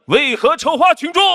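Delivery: high, loud shouted demand; loud indignant questioning. Acted. Crowd rises against the actor, loud indignant challenge. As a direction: cry out the accusation as an angry crowd. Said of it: "大声诘问".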